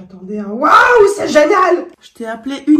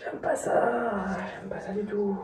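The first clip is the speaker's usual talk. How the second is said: whispering; 2 semitones lower, 13 dB quieter than usual. Real, not acted. Scared on the scaffolding.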